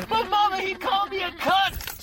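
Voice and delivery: put-on high, loud child's wail; hammed-up little girl crying out to mama; distressed little girl.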